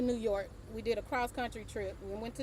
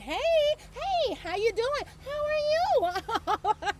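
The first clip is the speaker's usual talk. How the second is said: much higher, sing-song fake cheer; mock friendly greeting; 12 semitones higher, 4 dB louder, slower than usual. Imitating friendly passers-by New Yorkers never are, high sing-song cheer. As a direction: play the overly chipper stranger you won't meet there. Mocking overly friendly strangers.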